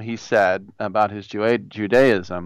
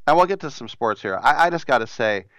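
ordinary talk

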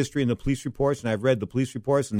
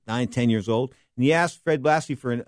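ordinary talk

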